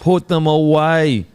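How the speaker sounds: "Put them away" said slowly, each word pressed; slow emphatic. Retold or imitated. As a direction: spell it out slowly, with weight. Repeating the coaching line.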